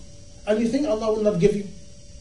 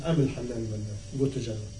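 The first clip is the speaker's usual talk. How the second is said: gentle divine voice; 10 semitones lower, 8 dB quieter, faster than usual. Acted. Voicing Allah's reply in story.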